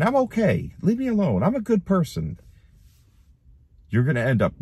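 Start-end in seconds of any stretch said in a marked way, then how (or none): 0.0-2.4 much higher, whiny brush-off; mock the stubborn sinner brushing God off; mocking dismissive voice. Preacher mocks a sinner refusing Jesus, high whiny brush-off.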